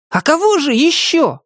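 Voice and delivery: high-pitched cocky retort; puffed-up little hero; reveling in bravado. A narrator's reading. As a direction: show off your bravery in a high voice. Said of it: "словно упиваясь своей храбростью".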